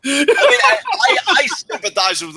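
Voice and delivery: high laughter breaking into the words; laughing while talking. Real, not acted. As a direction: try to sympathize through laughter. Laughing at the chat's reactions.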